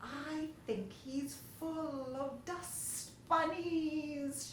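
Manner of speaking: put-on high, slow secretive old lady; confiding lady voice. A narrator's reading. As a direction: lean in and confide, slowly. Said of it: "she confided".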